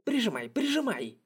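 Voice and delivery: high urgent egging on; battle cry. Acted. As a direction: urge him on in the fight. Battle cry urging comrade.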